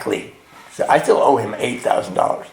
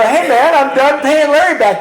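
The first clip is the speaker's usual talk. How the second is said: high, loud mock-triumphant news; acted excited announcement; 12 semitones higher, 5 dB louder than usual. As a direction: re-enact proudly breaking big news, pitched way up. Proudly phoning his sponsor in retelling.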